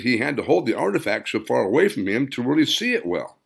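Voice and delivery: ordinary delivery, nothing marked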